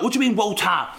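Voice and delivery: high, pointed mock outrage at "water"; aunt pounces on the slang "water"; mock indignant aunt. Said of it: "And then they're like".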